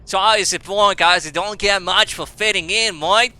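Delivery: loud, high, broad mock Australian; British turned Australian accent. Introducing a British character as Heath Clifford, Australian-sounding British accent. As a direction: put on British and slip into Australian. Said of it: "it's always Australian at the end".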